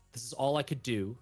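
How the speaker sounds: ordinary talk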